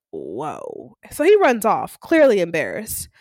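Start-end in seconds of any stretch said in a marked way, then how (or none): 0.1-1.0 very low, hushed whoa; quiet, low-pitched amazement; stunned reaction. Retold or imitated. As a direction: drop it deep and soft, as if stunned. Onlookers stunned by his huge body.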